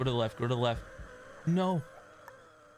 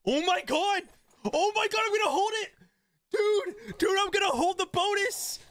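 excited. Real, not acted. Surprise survival in game.

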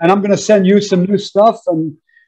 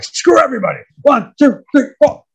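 high, emphatic retold defiance; acted-out determined count-off at a raised pitch; defiant count-in; 7 semitones higher than usual. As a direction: throw off the doubters and count in hard. Acts out defiant restart of count.

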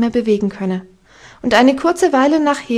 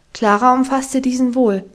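plain reading voice

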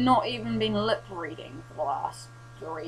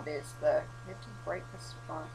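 ordinary talk, nothing marked

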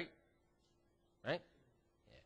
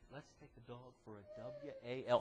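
secretive spelling; 10 dB quieter than usual. Retold or imitated. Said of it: "We'd say".

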